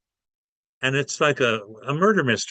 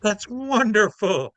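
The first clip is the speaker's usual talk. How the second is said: high, bubbly gushing voice, pitched well up; gushing reporter; 8 semitones higher than usual. Retold or imitated. Starstruck reporter gushing at Jesus, high and bubbly. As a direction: gush over him like a starstruck reporter.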